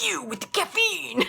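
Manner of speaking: imitated kid in a thick, high, drawn-out Italian accent; slow, high mock-Italian outburst. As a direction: accuse in a hammed-up Italian accent. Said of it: "In the thickest Italian accent".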